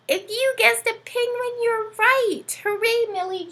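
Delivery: high bright celebratory reveal; excited cheer. Real, not acted. Cheering the children's penguin guess, excited. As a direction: cheer the right answer.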